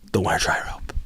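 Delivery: whispered punchline; whispered aside. Acted. Rant, then drops to whisper.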